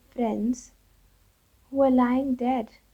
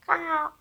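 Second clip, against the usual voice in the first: single high-pitched caw; acted bird squawk; imitated crow caw; 5 semitones higher than usual. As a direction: call out one short crow cry. Crow's cry imitated.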